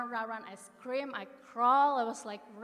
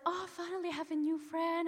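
higher, bright mock delight; 8 semitones higher, 4 dB louder than usual. Greeting the pig on the foggy trail, higher, delighted. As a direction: gush over a new friend.

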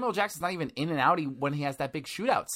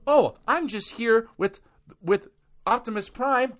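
mock actor voice; 7 semitones higher, slower than usual. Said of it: "they're like".

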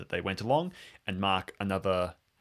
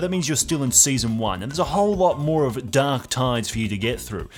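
loud, bright sponsor pitch; cheery podcast promo voice; upbeat ad read; 5 semitones higher, 7 dB louder than usual. Acted. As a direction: sell it like a radio host. Patreon ad read over music.

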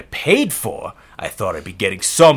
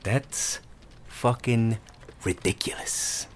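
muttered curse; 15 dB quieter than usual. Acted. Muttered curse under breath.

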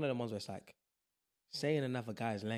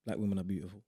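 deep poem recital; 4 semitones lower than usual. Acted. Performing own poem to a friend, deep recital. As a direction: recite it low and deliberately, like a poem. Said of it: "it's getting deeper and deeper".

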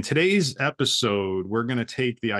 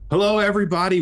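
bright, loud hello; big energetic welcome; excited greeting; 9 semitones higher, 6 dB louder, faster than usual. Real, not acted. Host greeting opening the show.

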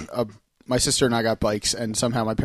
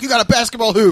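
put-on excited kid; 8 semitones higher, 5 dB louder than usual. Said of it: "he was so excited".

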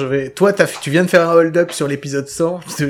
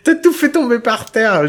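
laughing; 6 semitones higher, slower than usual. Real, not acted. Laughing at a dropped parcel.

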